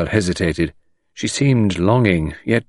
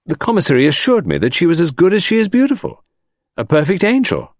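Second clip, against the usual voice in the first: high, indignant protest; crying out; 11 semitones higher, 3 dB louder than usual. A narrator's reading. Smitten man defending the girl, crying out in protest. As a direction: leap to her defence, pitch up. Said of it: "I cried".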